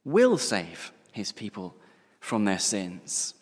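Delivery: emphatic will, slowed right down; stress on will. Shown as a demonstration. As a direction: press "will" hard and drag the phrase out. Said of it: "notice that he".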